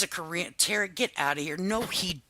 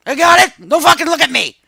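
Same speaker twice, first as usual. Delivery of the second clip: higher, louder fake fury at husband; mock angry snap; 9 semitones higher, 6 dB louder than usual. Acting out bedroom argument.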